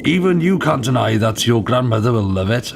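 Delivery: usual speaking voice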